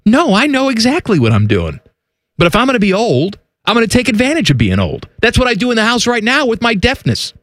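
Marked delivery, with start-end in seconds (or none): none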